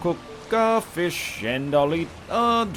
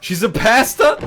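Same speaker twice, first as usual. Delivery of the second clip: surprised; 5 semitones higher, 7 dB louder than usual. Real, not acted. Mishearing pesta as pasta.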